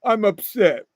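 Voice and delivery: mock upset girlfriend; mock sulking girlfriend. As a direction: mimic a girlfriend pouting. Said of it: "and then later on".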